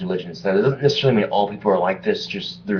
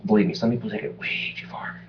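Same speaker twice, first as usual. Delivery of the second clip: whispered aside; 3 semitones higher, 4 dB quieter than usual. Real, not acted. Aside after loud line.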